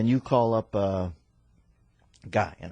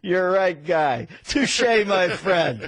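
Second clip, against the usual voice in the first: mock grand touche; 9 semitones higher, 6 dB louder, much slower than usual. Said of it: "mock grand reply".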